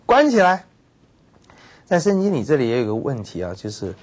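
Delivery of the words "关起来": high, loud curt order; angry command. Retold or imitated. Ahab furious at news of defeat, loud order. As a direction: snap the order to lock him up. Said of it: "他就非常生气啊".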